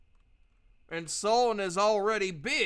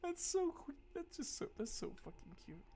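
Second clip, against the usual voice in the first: much softer, high then sinking gush; gushing hush; 7 semitones higher, 14 dB quieter than usual. Real, not acted. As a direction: melt over how cute it is. Gushing, fading voice.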